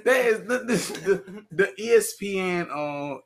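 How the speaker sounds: high, loud, laughing through the words; words breaking into laughter. Real, not acted. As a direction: giggle out the start of the story. Laughing while starting story.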